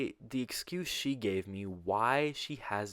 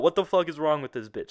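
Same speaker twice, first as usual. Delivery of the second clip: louder, higher exasperated outburst; punchy, raised-voice incredulity; emphatic repeat; 6 semitones higher, 8 dB louder, faster than usual. Shown as a demonstration. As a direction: say it out loud with amused disbelief. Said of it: "I'm gonna say that".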